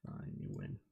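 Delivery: breathy muttered concession; sarcastic whispered fine you win. Real, not acted. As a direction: whisper it to yourself, defeated. Grudging give-up, whispered to self.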